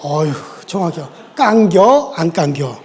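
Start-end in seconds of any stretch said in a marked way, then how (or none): 1.3-2.8 short, folksy Chungcheong drawl; 충청도 사투리로 짧게; Chungcheong dialect. Shown as a demonstration. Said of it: "충청도 말로 딱 줄여서 뭐라 그래요?".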